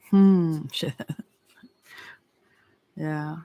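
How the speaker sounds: ordinary talk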